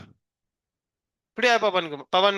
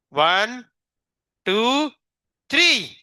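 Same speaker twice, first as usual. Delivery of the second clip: 1 rising count; 5 semitones higher, 4 dB louder, much slower than usual. Real, not acted. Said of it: "हम three तक count करेंगे".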